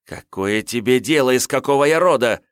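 high, loud angry snap; snarling. Acted. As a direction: snarl back at him. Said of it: "Ну, не рычи уже".

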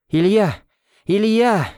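high frantic calling of Илья; panicked shout. Acted. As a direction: call out Илья in terror while running. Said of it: "закричала она на бегу". (испуганный крик на бегу)

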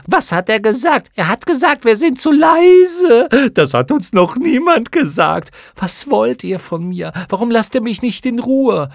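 very high, loud shrieking moth voices; excited chorus shouting. Acted. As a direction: shriek it gleefully as a swarm. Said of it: "Der ganze Chor schrie begeistert". (begeistert kreischender Mottenchor)

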